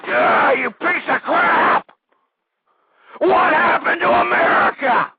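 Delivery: ranting scream at the liberal callers; furious scream. Real, not acted. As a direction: tear your throat on it. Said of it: "torn voice box".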